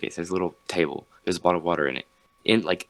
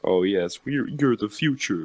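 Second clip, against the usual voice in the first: mocking TED Talks; 2 semitones higher than usual. Mocking how TED Talks sound, mock grandiose. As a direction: gush it in mockery of TED speakers. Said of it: "making fun of how, like, ted Talks".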